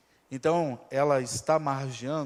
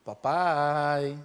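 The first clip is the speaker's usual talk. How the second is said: sweet daughter calling; 2 semitones lower, 3 dB louder than usual. Acted. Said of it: "falando assim".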